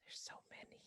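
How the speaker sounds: hushed unvoiced whisper. Real, not acted. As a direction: whisper it to yourself, flustered. Put on the spot by question.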